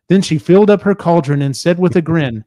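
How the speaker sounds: usual speaking voice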